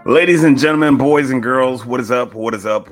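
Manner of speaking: booming ring announcer hype intro; grand entrance announcement. Acted. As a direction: belt it out like a stadium MC, easing off at the end. Host opens show like announcer.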